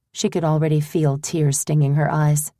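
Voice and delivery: ordinary delivery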